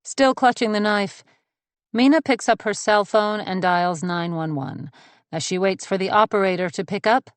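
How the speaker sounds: ordinary delivery, nothing marked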